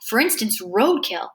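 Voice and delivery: ordinary delivery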